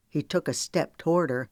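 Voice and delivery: ordinary delivery, nothing marked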